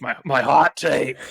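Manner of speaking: slow, growled metal vocals; Cookie Monster growl. Pretend. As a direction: growl it like a death metal singer. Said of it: "the metal singer Cookie Monster voice where I'm just, like, growling these out".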